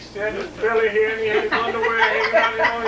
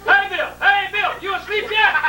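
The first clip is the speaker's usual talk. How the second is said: Dad calling out; 11 semitones higher than usual. Retold or imitated. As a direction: call out like a needy dad in bed. Dad calling son from bed.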